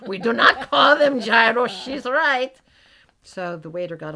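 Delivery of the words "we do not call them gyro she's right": higher, louder, slow firm correction; boss correcting firmly. Retold or imitated. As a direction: put the waiter right, firmly. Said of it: "turned around and said".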